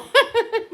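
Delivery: quick yelp of a laugh, high; a sudden high laugh; sharp high laugh. Real, not acted. Reacting to a viewer comment, a quick high laugh.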